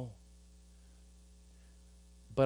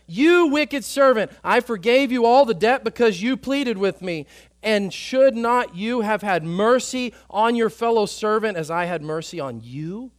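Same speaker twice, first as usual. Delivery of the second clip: stern rebuke; 7 semitones higher, 10 dB louder than usual. Acted. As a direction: condemn the wicked servant from on high. Master rebukes servant in parable.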